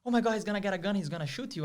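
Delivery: imitating her rising panic; freaking out. Girlfriend fearing the roommate has a gun, panicked and high. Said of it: "she started freaking out".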